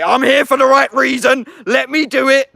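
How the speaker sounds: high, loud demanding yell; mock shout. What he would have shouted at the door, a loud high yell. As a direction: bellow it like a pushy troublemaker. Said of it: "I'm not gonna shout".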